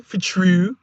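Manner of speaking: mimicked Baltimore 'true', rising; Baltimore-accented 'true', fronted oo; mock Baltimore accent. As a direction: tease the Baltimore vowel in 'true'. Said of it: "She trying to tell you things that are true".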